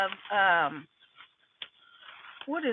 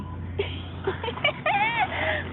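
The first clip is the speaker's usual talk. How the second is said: high laugh. Real, not acted. Laughing at own idea.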